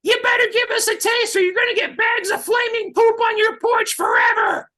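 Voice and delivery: very high yelled threat, hammed up; high-pitched comic yelling; mock threatening shout. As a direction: holler like a kid making a big threat, stretching the end. Acts out kids threatening the owner.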